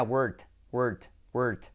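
"word" cut short with a hard final T; mispronounced as wart. Retold or imitated. Teacher recalls his old mistake, word ending in T. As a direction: say "word" wrongly, as "wert". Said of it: "me salía verruga".

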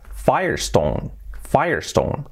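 Firestone as "faier-ston", first slowly; English pronunciation. Shown as a demonstration. Teacher drilling learners, English Firestone slowly. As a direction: repeat Firestone in English, slowed. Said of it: "la pronunciación es".

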